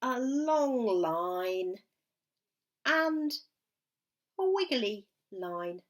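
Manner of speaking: very slow long line; stretched out. Shown as a demonstration. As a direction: draw out long like the line itself. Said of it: "I'd like you to draw".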